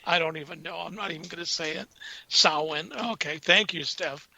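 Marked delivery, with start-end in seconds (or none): 2.3-2.9 Samhain said as "SOW-in", rhyming with cow plus in; Samhain, pronounced "SOW-in" and not as spelled; Samhain said carefully. Shown as a demonstration. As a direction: give Samhain the correct Gaelic-style "SOW-in". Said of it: "correct verbiage".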